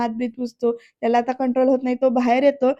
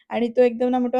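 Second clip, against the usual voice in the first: ordinary delivery, nothing marked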